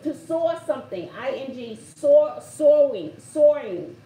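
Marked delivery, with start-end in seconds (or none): 2.0-4.0 Soaring said slowly and loudly, r stressed; slow careful demo. Shown as a demonstration. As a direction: spell out soar then soaring, slow and loud. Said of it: "How do I say it, Bobby?".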